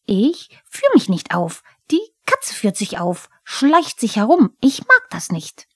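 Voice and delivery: a huffy little goblin scoffing at the cat; Pumuckl the kobold. Acted. Pumuckl streitet mit Eder.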